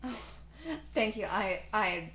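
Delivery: ordinary delivery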